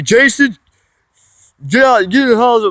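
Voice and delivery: high, sharp retold yell at the kid; yelling at him. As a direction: bark the order up high. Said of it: "he yells at me".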